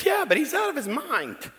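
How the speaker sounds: high mock protest. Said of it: "I said".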